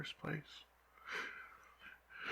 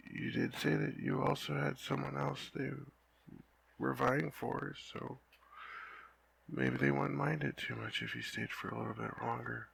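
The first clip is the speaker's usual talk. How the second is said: ordinary talk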